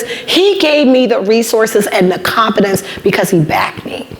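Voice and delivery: ordinary delivery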